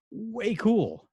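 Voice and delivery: high, loud put-on surfer voice; mock stoner enthusiasm; goofy excited. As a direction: gush like a dude. Gushing about the interview.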